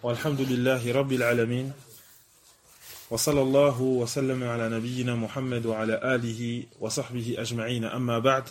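low, soft, rapid formulaic recitation; opening invocation. Acted. Teacher opening the lesson with praise of God, rapid formulaic recitation.

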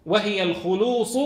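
الخلوص said al-khulus with a u on kha; وهي الخلوص, kha with damma, said slowly. Shown as a demonstration. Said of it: "اضبطوها بالضم".

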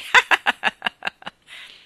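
loud burst of mocking laughter; roaring laugh. A narrator's reading. Starling laughing at the stupidi cuculi, roaring laughter. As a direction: laugh out in a loud, rattling cackle. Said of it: "fragoroso scoppio di risa".

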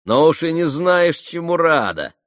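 громкая, высокая насмешливая реплика; подколи её с усмешкой. Acted. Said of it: "усмехнулся Ракитин". (насмешливо, с поддёвкой)

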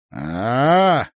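loud rising "aah" of relief; relieved sigh "Ah". A narrator's reading. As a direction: let out a long, relieved "aah". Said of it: "облегчённо вздохнул он". (облегчённое громкое «а-а-а»)

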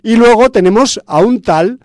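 ordinary talk

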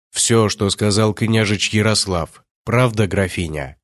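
ordinary delivery, nothing marked

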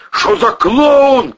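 loud, high, drawn-out shouted question. A narrator's reading. Man seeing a strangely dressed creature, shouting. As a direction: shout it in disbelief. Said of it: "крикнул Протасов".

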